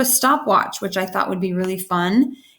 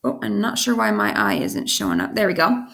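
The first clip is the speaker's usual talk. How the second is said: ordinary talk, nothing marked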